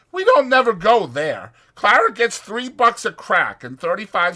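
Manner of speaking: chatty ranch hand selling a night in town; Whit's voice. Acted. Voicing Whit.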